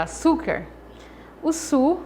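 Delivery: "su" in "açúcar" lifted high and stretched; stressed syllable. Shown as a demonstration. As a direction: lean on "su", the tonic syllable. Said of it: "é a sílaba tônica". ("su" como sílaba tônica)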